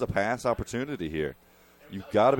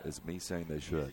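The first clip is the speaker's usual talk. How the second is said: sly aside; 6 semitones lower, 10 dB quieter than usual. Real, not acted. Sly hint to the coaches.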